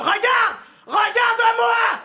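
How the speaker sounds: high, loud panicked shout; mother shouting at her son in the street; mother yelling. Acted. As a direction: yell to grab the kid's attention.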